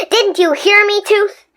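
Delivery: cried out. Acted. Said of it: "cried Stuart".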